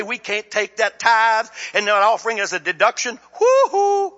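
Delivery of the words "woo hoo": high, loud woo-hoo; a mocking whoop of joy; mock cheer. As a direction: whoop it up sarcastically. Mock celebration shout.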